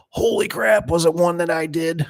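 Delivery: high, alarmed holy crap; shocked panic. Retold or imitated. Installer fearing his own door killed someone, high alarmed panic. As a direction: panic at the thought it was his job.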